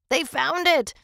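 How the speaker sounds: loud, high burst of excitement; excited outburst. Acted. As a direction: blurt out the big news excitedly. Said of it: "He was excited".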